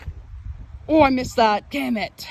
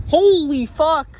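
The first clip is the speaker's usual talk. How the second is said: loud, high, slow exclamation; excited shout; 6 semitones higher, 6 dB louder than usual. Real, not acted. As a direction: yell it out in shock. Huge lightning strike.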